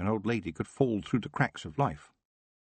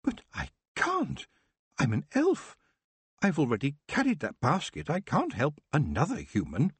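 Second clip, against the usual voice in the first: an elf aghast at helping a human; horrified; 7 semitones higher than usual. Acted. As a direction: recoil in alarm at the request. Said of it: "Nightshade was horrified".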